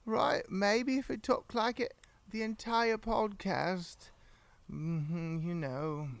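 high, tipsy British pirate voice; drunk pirate British accent. Acted. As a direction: put on a British pirate accent to win her over. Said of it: "and so I talk like this".

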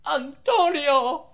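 high, slow melodramatic call of the name; soap opera name. Retold or imitated. Spoofing a soap opera, a high dramatic call. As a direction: draw the name out like a telenovela cliffhanger. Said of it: "It's like".

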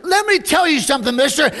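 high-pitched retold distress; upset lady. Tearful young lady confronting the soul winner, worked up. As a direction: imitate a worked-up woman confronting a caller. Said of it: "She got tears in her eyes".

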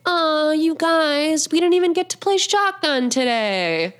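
put-on high, slow whiny little girl; disappointed kid. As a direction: drag out the Aw like a let-down child. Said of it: "was like".

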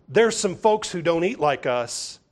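ordinary talk

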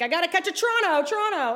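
panicked rush. Retold or imitated. Fleeing the stalker.

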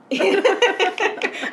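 a big delighted laugh that falls away; loud high laugh. Real, not acted. Big laugh at snowball joke.

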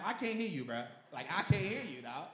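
teasing call-out. Real, not acted. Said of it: "people online yelling it out".

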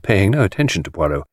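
ordinary delivery, nothing marked

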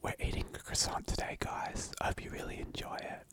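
soft whispered ASMR voice. Pretend. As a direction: whisper close to the mic like an ASMR video. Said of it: "So there was the A S M R section".

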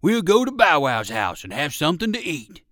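a big friendly dog offering food; old circus dog voice. Acted. Percival the dog invites.

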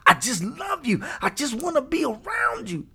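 high, gushing clingy admirer; hammed-up infatuated squeal; lovestruck stalker. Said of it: "it's almost like, you know, stalking 'em".

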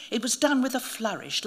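plain reading voice

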